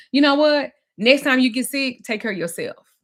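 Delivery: imitating a partner's angry ultimatum; retold angry threat. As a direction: raise the voice to punish her. Retold quarrel with Damon.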